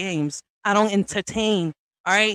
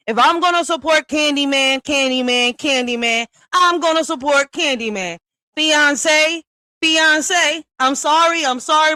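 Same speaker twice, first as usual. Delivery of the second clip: higher and louder, repeating Candyman with glee; hyped shout-out; 6 semitones higher, 5 dB louder than usual. Real, not acted. Streamer hyping Candyman to her viewers, excited and loud. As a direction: cheer the name like a fan hyping a friend.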